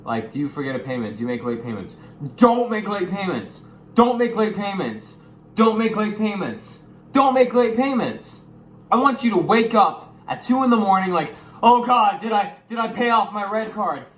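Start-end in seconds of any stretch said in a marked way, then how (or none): 2.4-8.4 higher, louder, slow repeated warning; worked up. Real, not acted. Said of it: "I get a little, little worked up". 8.9-14.0 higher, loud panicked rant; worked-up mock panic. Real, not acted. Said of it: "I get a little, little worked up".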